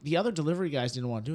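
normal talking voice

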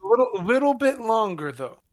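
loud, high reassuring call. Acted. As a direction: call out to the hostages. Calling out to hostages.

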